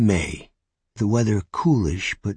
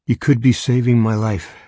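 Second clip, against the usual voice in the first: desperate plea; 3 semitones higher, 6 dB louder than usual. Acted. Scared stranger begs for help.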